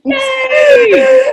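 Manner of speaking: high, loud happy shout; excited cheer. Real, not acted. Celebrates finishing the questions.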